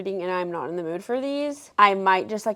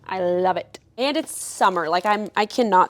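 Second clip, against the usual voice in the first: usual speaking voice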